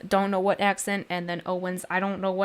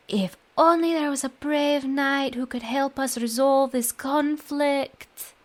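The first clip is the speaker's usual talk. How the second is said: higher voice, drawing out the last words; hinting quest character; 6 semitones higher than usual. Acted. Adventurer hinting that the knight should help, higher and drawn out at the end. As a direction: coax the knight with a pointed, higher-pitched wish.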